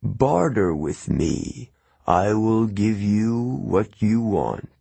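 slow, heavy, ancient voice; ancient cold voice. A narrator's reading. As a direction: speak like something old and cold from a pit. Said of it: "it said".

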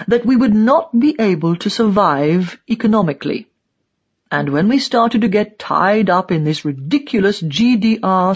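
put-on self-justifying old die-hard in character voice; emphatic old East German commentator; old propagandist. A narrator's reading. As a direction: recount it as a proud old ideologue.

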